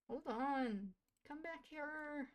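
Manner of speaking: calling after a sim who wanders off. Real, not acted. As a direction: plead for them to come back. Calling to sim walking away.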